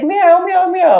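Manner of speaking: high-pitched imitation meows; plaintive repeated kitty cries, mimicked; cat meowing. Imitating the cat calling from upstairs, high meowing. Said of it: "and he's meowing".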